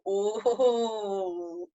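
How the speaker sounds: long, falling "ohhh"; gossip-hungry reaction. Acted. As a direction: savour the scandal on a drawn-out "oh". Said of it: "You've heard the biz from your friend".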